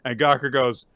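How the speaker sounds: normal talking voice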